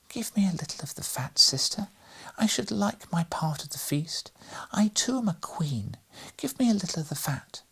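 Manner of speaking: high, whimpering, whispered little voice; whimpering mouse voice. A narrator's reading. Lady Mouserings begs the Queen for fat, high whimpering whisper. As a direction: beg like a tiny hungry mouse. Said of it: "a small whimpering, whispering voice".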